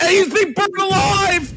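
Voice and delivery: excited. Real, not acted. Caster peaks on the call.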